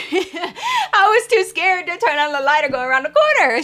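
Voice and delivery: high, laughing through the words; laughing confession. Real, not acted. Storyteller admits she was too scared, laughing and high. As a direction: crack up while admitting it, pitch leaping up. Laughing at her own fear.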